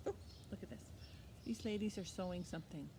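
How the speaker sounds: ordinary talk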